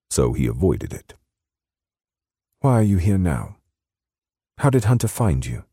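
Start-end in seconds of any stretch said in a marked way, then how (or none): 2.6-5.6 high British woman's voice; British accent, questions pitched high. A narrator's reading. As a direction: press him for answers in a British accent. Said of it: "in her soft British accent".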